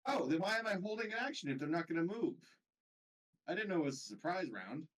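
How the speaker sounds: annoyed, rising complaint; real indignant protest. Real, not acted. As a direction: push back on the ruling, voice climbing. Player objects to game ruling.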